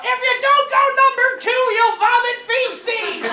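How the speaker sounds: loud, high, slow yell; yelled warning. Retold or imitated. Grandma yelling into the crowd of cousins, loud and high. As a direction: bellow it across the room like grandma. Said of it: "Grandma yells into the crowd".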